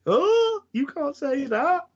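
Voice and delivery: high shocked squeak; squeaky Pinky voice. Retold or imitated. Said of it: "And Pinky obviously".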